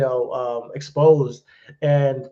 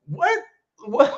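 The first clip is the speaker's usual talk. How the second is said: high-pitched, incredulous replay of a reaction; baffled disbelief; 10 semitones higher than usual. Retold or imitated. Wrestling fan recalling his reaction to Raw, baffled. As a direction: let the question leap up in confusion, with a laugh.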